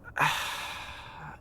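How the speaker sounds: long breathy sigh; weary sigh. Acted. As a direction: let out a long resigned sigh. Servant sighs at her highness.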